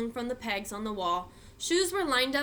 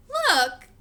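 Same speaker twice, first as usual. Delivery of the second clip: high, loud giggly taunt; giggly teasing; 14 semitones higher, 9 dB louder, slower than usual. A narrator's reading. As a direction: tease your sister through giggles. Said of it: "came Jad's giggly voice".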